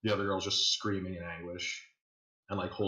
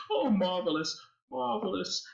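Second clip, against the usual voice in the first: delighted villain host; 11 semitones higher than usual. Acted. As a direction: purr it like a sadistic aristocrat.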